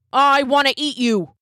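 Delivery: lightly voiced cop; police officer. A narrator's reading. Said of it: "said the cop".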